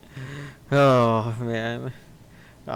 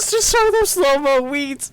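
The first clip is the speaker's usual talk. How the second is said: laughing impression; 6 dB louder than usual. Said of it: "He just goes".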